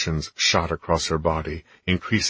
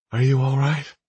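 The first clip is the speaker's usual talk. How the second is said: female character voice; 8 semitones higher, 4 dB louder than usual. A narrator's reading. Heroine asks gently.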